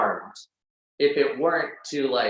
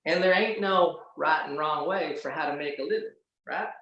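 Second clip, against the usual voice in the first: put-on Southern accent; 3 semitones higher than usual. To the host, showing how Southerners really talk, lightly Southern. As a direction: lean into a lazy Southern twang. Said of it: "Talk like this all day".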